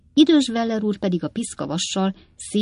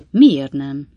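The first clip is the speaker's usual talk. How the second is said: doubtful short question, loud but not raised; 2 semitones lower, 9 dB louder than usual. A narrator's reading. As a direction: question it sceptically. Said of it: "kétkedő hangon".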